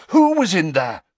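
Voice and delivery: loud, high-pitched demanding question; urgent demand. A narrator's reading. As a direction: press urgently for an answer, loudly. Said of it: "demanded Harry".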